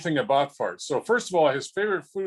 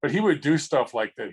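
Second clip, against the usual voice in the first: normal talking voice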